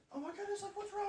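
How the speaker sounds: put-on high alarmed fussing over a child; mock panicked fuss. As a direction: overreact in fake alarm. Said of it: "if you're just like".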